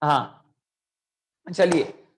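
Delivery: ordinary delivery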